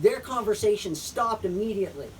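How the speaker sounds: usual speaking voice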